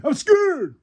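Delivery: high, loud, cartoon fearful squeak; hammed-up fearful plea; mock scared Tony. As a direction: squeak it out like a frightened underdog. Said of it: "You know, Tony".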